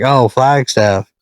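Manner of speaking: ordinary talk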